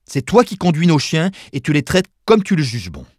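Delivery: high, loud, emphatic declaration; defiant sister. A narrator's reading. Sister loudly defends her brother.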